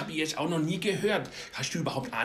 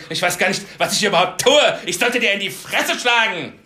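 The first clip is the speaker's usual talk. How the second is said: slow, then louder and higher rant; Kinski rage; 4 semitones higher, 6 dB louder than usual. Acted. Kinski yells at the folk-music host, furious. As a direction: build up a furious tirade.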